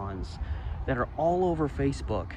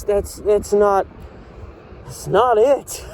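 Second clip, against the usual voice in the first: usual speaking voice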